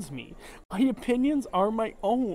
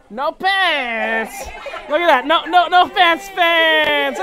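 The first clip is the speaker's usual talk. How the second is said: excited shout; 6 semitones higher, 14 dB louder, slower than usual. Real, not acted. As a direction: shout it out across the deck, thrilled. Drunk shouting at passersby.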